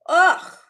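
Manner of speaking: loud, high groan of disgust; loud grossed-out ugh. Real, not acted. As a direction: recoil from the smell. Disgust at smell story.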